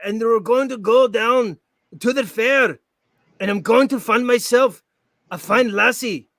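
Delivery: high, slow mock Scottish; hammed-up Scottish brogue; Braveheart Scottish accent. Shown as a demonstration. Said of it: "I just do Braveheart".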